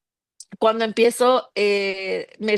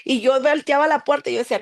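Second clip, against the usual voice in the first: ordinary talk, nothing marked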